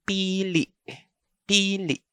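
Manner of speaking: pili with long first vowel, second cut by a stop; stopped final vowel. Shown as a demonstration. Teacher demonstrating the next pronunciation of pili, long vowel then stop. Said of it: "second vowel has a stop".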